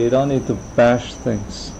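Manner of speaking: ordinary delivery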